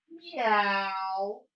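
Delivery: soft, mild cat meow. Pretend. Teacher leading kids in a scaredy cat meow, soft. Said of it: "onto your fingertips and go".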